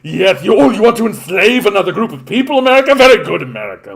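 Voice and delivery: high, very loud, fawning and fast yes-man; groveling toady. Pretend. Canada fawning over America with a shrieking sycophantic voice. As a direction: gush like a spineless sycophant. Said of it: "then we might as well just be like".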